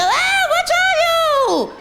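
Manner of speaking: high, loud, drawn-out mock scream; scared scream. Shown as a demonstration. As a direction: scream it out as if terrified. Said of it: "Like, scream, go".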